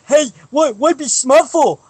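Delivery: imitated migrant Sydney accent. Retelling locals challenging him, imitated migrant Sydney accent. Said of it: "the wogs going".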